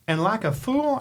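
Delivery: ordinary delivery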